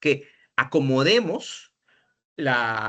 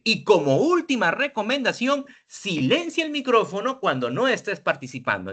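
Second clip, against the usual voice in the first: teacher raising the tone to regain attention; raised intonation; 6 semitones higher than usual. Shown as a demonstration. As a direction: lift your voice up to wake the class. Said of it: "la entonación, ¡pic!, ¿no? Subió".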